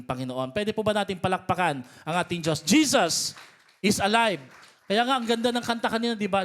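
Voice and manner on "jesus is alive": loud, triumphant shout to the congregation; rousing proclamation. Real, not acted. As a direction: proclaim it loudly to draw applause. Preacher calls for applause to God.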